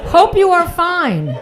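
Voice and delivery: reading the letter slowly and loudly, mocking it; mock scam letter read. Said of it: "There's now absolutely no punctuation".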